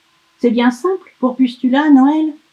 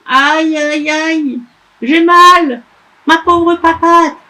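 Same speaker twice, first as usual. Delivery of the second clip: whimpering little elf; 8 semitones higher, 7 dB louder, slower than usual. Acted. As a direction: whine it like a hurt little boy. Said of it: "le lutin s'est fait mal et gémit".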